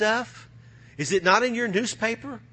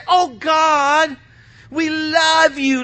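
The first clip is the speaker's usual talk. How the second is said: mock worship; 5 semitones higher, 4 dB louder, much slower than usual. Mocking insincere worshipper prayer.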